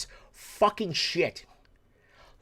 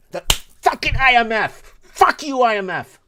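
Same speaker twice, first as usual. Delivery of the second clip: loud, higher furious outburst at the IMF; angry shout; 4 semitones higher, 6 dB louder than usual. Real, not acted. Host ranting at the IMF on air, furious shout. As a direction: blow up and yell it out.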